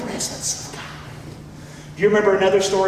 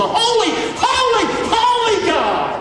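high, ringing preacher's cry; emphatic proclamation; 11 semitones higher, slower than usual. Real, not acted. Preacher proclaims God's holiness to the congregation, impassioned cry. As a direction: cry each holy out high, drawing it out.

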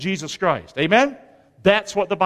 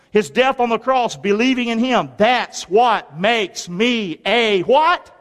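ordinary talk, nothing marked

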